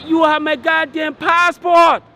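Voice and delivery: slow, high-pitched retold shout; angry outburst. Telling the lady she has the passport, angry shout. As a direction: call it out high, stretching each word.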